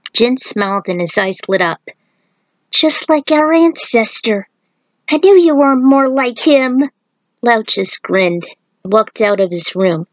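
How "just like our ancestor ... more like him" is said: put-on young Jin. A narrator's reading. Child Jin admires his uncle.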